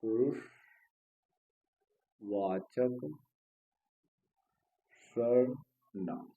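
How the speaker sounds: पुरुषवाचक सर्वनाम drawn out, long pause between the words; slow dictation. Shown as a demonstration. Dictates term while writing it.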